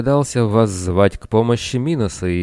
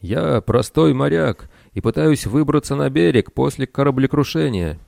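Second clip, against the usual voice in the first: calling out; 7 semitones higher than usual. A narrator's reading. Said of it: "сложил ладони у рта и крикнул Талосу".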